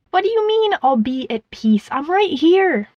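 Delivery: high-pitched, protesting outburst; child's indignant cry, jumping up high; indignant yell. A narrator's reading. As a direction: yell in protest at being ignored. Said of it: "yelled the ballerina".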